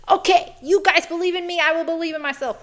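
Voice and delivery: rally herself with a laugh; giddy self pep talk; pumped up. Retold or imitated. Pumped up after friends' push.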